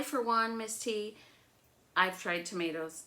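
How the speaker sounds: ordinary delivery, nothing marked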